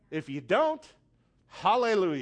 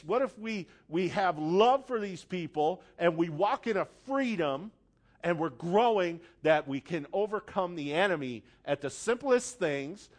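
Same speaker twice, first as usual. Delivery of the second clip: normal talking voice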